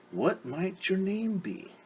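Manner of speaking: a lightly voiced wolf, slow and smooth; smooth-talk the girl while hiding hunger; sly wolf. Wolf sweet-talking Little Red on the path, slyly.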